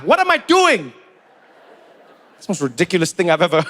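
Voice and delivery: usual speaking voice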